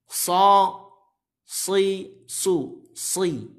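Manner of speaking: "So, si, su, si" with a heavy, thick s; thick sad. Shown as a demonstration. Teacher shows the letter must be thick, "So, si, su, si" heavy. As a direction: raise the back of the tongue on each s of "So, si, su, si". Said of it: "Maka dia harus tebal".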